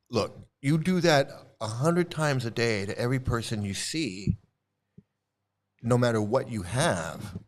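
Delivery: normal talking voice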